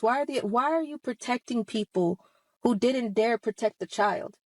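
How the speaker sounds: ordinary talk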